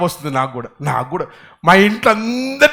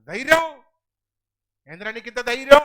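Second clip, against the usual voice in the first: mock scolding challenge; 5 semitones higher, 3 dB quieter than usual. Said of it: "అంటారు కదా". (గద్దించి అడిగినట్టు)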